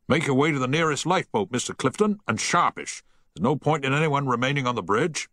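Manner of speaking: fast, high urgent command; urgent order. A narrator's reading. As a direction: hurry him off the bridge. Captain ordering Harry off bridge.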